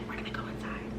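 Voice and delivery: hushed conspiratorial whisper, retold. Telling the other woman they are going inside, whispered. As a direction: whisper the plan so nobody inside hears. Secretly planning outside with sister.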